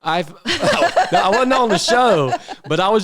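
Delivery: loud laughing denial. Real, not acted. Laughing off a joke.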